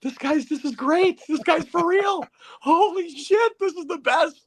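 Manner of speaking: rising, giddy delight; thrilled whispers building to a cheer; gleeful disbelief. Retold or imitated. Friends in the car delighted by Perry's meltdown, building gleeful excitement. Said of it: "we all look at each other like".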